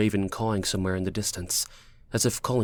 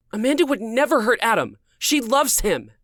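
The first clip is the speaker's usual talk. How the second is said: high, insistent female character voice; high-pitched, upset protest; indignant woman; 13 semitones higher, 5 dB louder than usual. Acted. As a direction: defend your friend, pitched way up and loud. Woman defends her friend indignantly.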